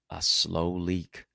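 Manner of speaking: slow, drawn-out emphasis. Real, not acted. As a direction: stretch each word out slowly. Key phrase drawn out for emphasis.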